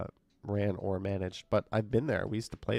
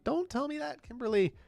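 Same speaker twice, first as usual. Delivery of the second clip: mock dismay. Real, not acted. Playful protest at viewer comment.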